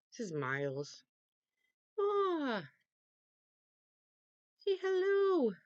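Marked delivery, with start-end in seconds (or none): 2.0-5.5 calling the cat. Real, not acted. Calling her cat Miles to come, high coaxing pet voice. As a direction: coax the cat over in a high coo.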